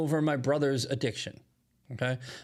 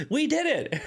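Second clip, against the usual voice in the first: much higher, louder mock celebration; mock triumphant cheer; 14 semitones higher, 3 dB louder than usual. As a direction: play the naive supporter crowing about their win. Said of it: "they walk up and they go".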